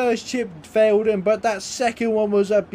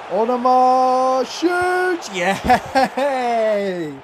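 higher, drawn-out shot call into a happy laugh; excited goal call; 5 semitones higher, much slower than usual. Real, not acted. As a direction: cheer the goal as it lands. His striker scores.

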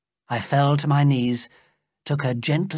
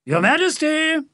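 much higher, louder calling voice from outside; Sapt calling; 4 dB louder than usual. A narrator's reading. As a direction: call out from the garden, high and carrying.

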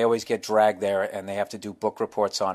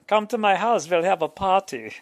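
high put-on party-guy call; imitating the crowd guy; 8 semitones higher than usual. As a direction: shout the invite like a goofy fan. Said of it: "it would be him just going".

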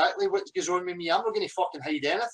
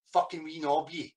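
normal talking voice

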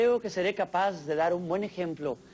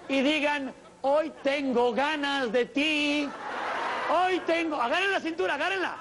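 high-pitched pushy party host; hammed-up high party emcee ordering everyone to dance; party animator; 8 semitones higher than usual. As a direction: boss the crowd around like a cheesy animator. Plays party animator for many lines.

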